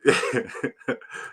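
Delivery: helpless quiet chuckling; wheezy run of chuckles; amused laugh. Real, not acted. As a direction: laugh under your breath. Amused by the mispronunciation.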